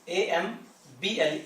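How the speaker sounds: spelling aloud; spelling out letters. Shown as a demonstration. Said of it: "कौन सा, एम्बल".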